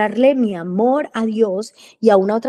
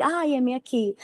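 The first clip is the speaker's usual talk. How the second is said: soft, higher-lilting mock readiness; mock eager; 3 semitones higher, 5 dB quieter than usual. Believer answering God's promise of blessing, mock eager. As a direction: play the all-too-willing believer, lightly. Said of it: "te voy a bendecir".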